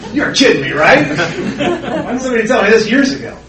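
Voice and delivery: incredulous and amused; laughing disbelief. Real, not acted. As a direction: still laughing at how simple it was. Laughing disbelief after punchline.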